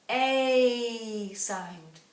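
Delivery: A stretched out and loud; long A sound. Shown as a demonstration. Teacher showing the class the long A sound. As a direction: sing out the long A vowel. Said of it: "we're gonna write the".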